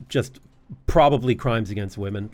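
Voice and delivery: ordinary talk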